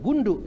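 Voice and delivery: "gundu" with a hard, heavy Betawi D; Betawi dal. Retold or imitated. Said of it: "Coba orang Betawi". ("gundu" dengan dal logat Betawi)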